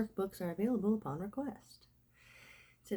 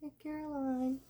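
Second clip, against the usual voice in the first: group reply voice; 9 semitones higher than usual. Pretend. Group response at a meeting.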